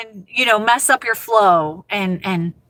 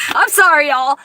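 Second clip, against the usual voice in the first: ordinary delivery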